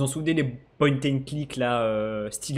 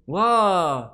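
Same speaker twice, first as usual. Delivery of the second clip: excited; 5 semitones higher, 8 dB louder than usual. Real, not acted. Impressed by the game map.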